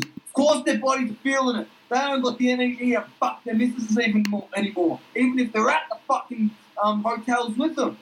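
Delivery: high cocky bloke, brag it out; Duck's voice. Acted. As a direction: raise the pitch and swagger through it. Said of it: "And then Duck says".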